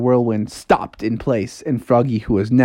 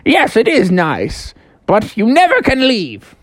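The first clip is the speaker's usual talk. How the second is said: talking house; 9 semitones higher than usual. Acted. House warns Froggy he can never leave, high mock menace. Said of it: "He's like".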